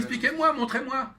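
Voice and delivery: insistant, plus aigu et plus fort; réclame comme quelqu'un qui est perdu sans explications; demandeur incapable. Acted. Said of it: "quelqu'un qui est nul, il va dire". (exigence pressante, voix haut perchée)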